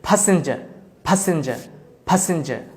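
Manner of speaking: "প্যাসেঞ্জার" starting ph, ending -ja without r; aspirated P, silent R. Shown as a demonstration. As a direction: drill "প্যাসেঞ্জার" British style with puffed p. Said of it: "silent হয়ে যাবে". ("প্যাসেঞ্জার" ফ দিয়ে, শেষে র ছাড়া)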